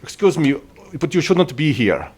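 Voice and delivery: in-character order to strangers; unseen temple voice. Acted. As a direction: call out to intruders to keep out. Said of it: "as a voice says".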